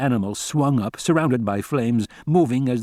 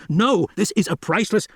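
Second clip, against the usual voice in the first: high, desperate shout; pleading shout at the soldiers burning the carcass; 9 semitones higher than usual. A narrator's reading. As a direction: yell to save the specimen. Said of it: "He shouted".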